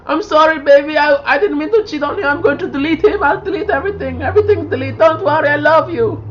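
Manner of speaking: high mock pleading, attempted Russian accent; pleading Russian girlfriend. Acting out a cheating date begging her boyfriend, high mock pleading. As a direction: beg in a high, badly faked Russian woman's voice. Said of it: "I can't do a Russian accent".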